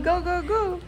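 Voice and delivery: usual speaking voice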